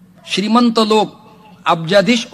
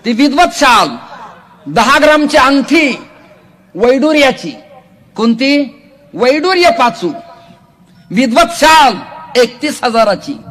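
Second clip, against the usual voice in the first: high, loud proclamation; preaching at full force; 8 semitones higher, 7 dB louder than usual. Acted. Preacher proclaiming the gifts he received, high and loud. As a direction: boast it out to the hall.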